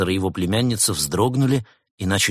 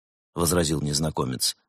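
usual speaking voice

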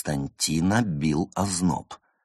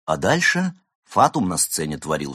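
ordinary delivery

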